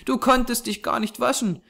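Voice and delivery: put-on high child's voice, earnest; serious little girl. A narrator's reading. Small girl studying the man's dark face, earnest. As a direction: cut in with a small girl's high voice. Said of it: "ihm ernsthaft in das dunkle Gesicht blickend".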